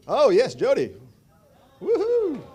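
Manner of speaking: high, loud celebratory whoop; excited cheer. Real, not acted. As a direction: cheer the winner loudly. Winner drawn at raffle.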